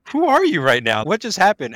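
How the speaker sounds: retelling thanking a kind cashier, amazed; stunned disbelief. Retold or imitated. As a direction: blurt it out, overwhelmed by kindness. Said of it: "I'm like".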